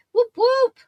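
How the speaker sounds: high, loud whoop of celebration; excited cheer. Real, not acted. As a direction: cheer it out like a fan. Cheering viewer's praise.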